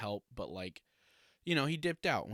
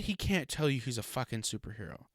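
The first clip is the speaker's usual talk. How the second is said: ordinary talk, nothing marked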